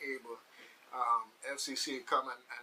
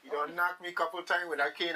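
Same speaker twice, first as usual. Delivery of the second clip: ordinary delivery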